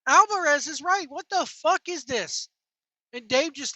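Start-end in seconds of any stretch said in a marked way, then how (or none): none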